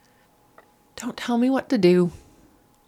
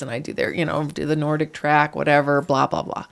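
ordinary talk, nothing marked

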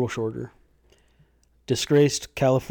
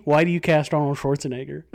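ordinary delivery

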